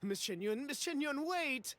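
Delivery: rising, urgent call after her. Acted. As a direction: call her back in a panic. Calling after departing collector.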